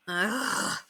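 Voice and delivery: soft groaning ugh; quiet frustrated groan. A narrator's reading. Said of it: "Henry groaned".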